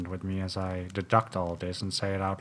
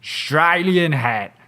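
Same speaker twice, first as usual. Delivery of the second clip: excited clue; 6 semitones higher, 8 dB louder than usual. Acted. Detective listing clues.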